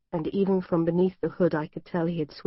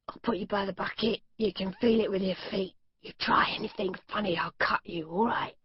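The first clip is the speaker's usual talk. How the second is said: menacing captor. A narrator's reading. Captor threatens her.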